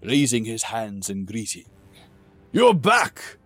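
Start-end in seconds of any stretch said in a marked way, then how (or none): 2.5-3.4 high, loud, jolly king voice; booming warrior king. Acted. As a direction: boom out a hearty welcome. King greets joyfully.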